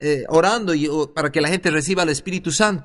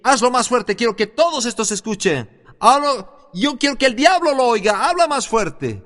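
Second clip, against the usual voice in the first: raised, higher voice pressing him on; mildly forceful retold command, pushing for more volume; insistent urging; 5 semitones higher than usual. Preacher urging the man praying in tongues to speak up, insistent urging.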